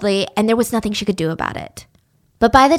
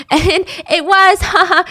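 laughing; 8 semitones higher, 3 dB louder than usual. Real, not acted. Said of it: "speaker laughs".

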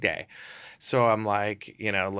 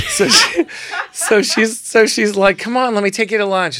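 excited; 11 semitones higher, 10 dB louder than usual. Retold or imitated. Excited retelling of invitation.

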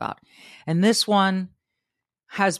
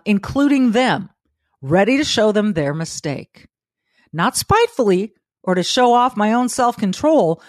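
higher, louder, earnest recitation; reading Marcus Aurelius; 4 semitones higher, 4 dB louder than usual. Acted. Host reading Meditations aloud, earnest lifted recitation. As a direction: recite like a creed. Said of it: "here's what he writes".

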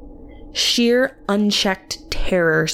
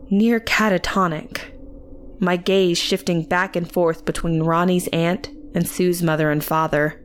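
ordinary delivery

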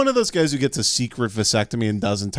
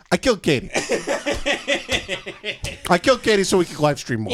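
Silvio impression; 7 semitones higher than usual. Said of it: "I've got the Silvio hair and I go".

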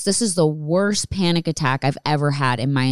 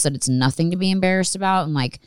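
normal talking voice

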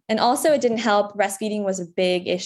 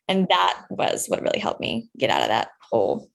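ordinary delivery

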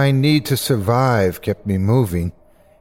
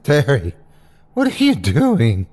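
tearful; 3 semitones higher than usual. A narrator's reading. Narrator in the dark to Terry, tearful. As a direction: stammer out a frightened question. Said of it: "Couldn't stop the tears".

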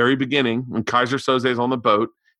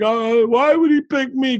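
much higher, timid whining plea; scared whine; 12 semitones higher, 4 dB louder than usual. Retold or imitated. Verbal asking the cops why him, high frightened whine. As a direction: plead like a frightened weakling. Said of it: "he's like".

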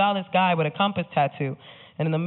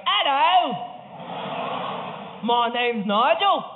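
drawn-out comic Cockney British impression; Cockney accent; 5 semitones higher, slower than usual. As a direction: put on a broad, high-pitched Cockney. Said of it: "had a Cockney British accent".

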